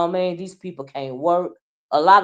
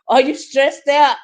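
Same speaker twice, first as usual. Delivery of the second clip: mocking laugh; 6 semitones higher, 7 dB louder than usual. Real, not acted.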